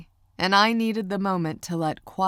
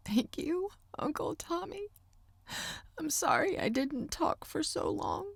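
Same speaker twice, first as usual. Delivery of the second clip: much higher, whispered thanks; high-pitched tone; 11 semitones higher, 8 dB quieter than usual. A narrator's reading. Niece thanks her uncle, high-pitched and whispery. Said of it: "I spoke in a high-pitched tone".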